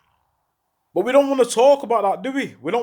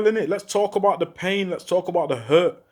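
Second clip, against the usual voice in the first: ordinary talk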